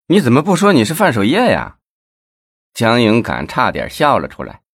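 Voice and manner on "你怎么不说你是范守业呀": jeering high rise on 范守业, dropping off at the end; high mocking 范守业; mocking sneer. A narrator's reading. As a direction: scoff at him as if the claim is absurd. Guard mocks beggar's claimed name.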